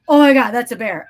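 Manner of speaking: loud alarmed call to friends; alarmed exclaim. Retold or imitated. Warning Megan and Kelly in the dark yard, loud alarm. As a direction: sound the alarm loudly.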